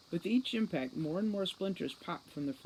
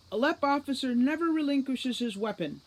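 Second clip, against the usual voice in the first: proud declaration; 8 semitones higher, 6 dB louder than usual. Acted. Grubb draws himself up proudly.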